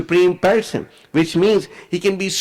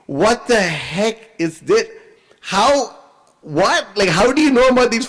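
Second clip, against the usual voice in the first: stunned, slow start then higher-pitched disbelief; shocked; 5 semitones higher, slower than usual. Retold or imitated. Duryodhana asked for the secret arrows, shocked. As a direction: act out dumbfounded shock, halting between words. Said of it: "He was shocked, you know".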